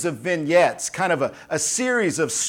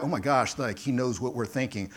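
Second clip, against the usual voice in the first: hushed alarm; 6 semitones lower, 7 dB quieter than usual. Retold or imitated. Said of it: "they're like".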